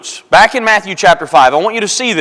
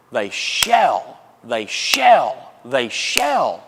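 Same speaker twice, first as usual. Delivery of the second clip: low, measured "shall" each time, pressed down; emphatic; 7 semitones lower, 5 dB quieter, much slower than usual. Retold or imitated. Preacher quoting Jesus, low and firmly emphatic. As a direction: state "shall" as absolute fact. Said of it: "He is stating emphatically".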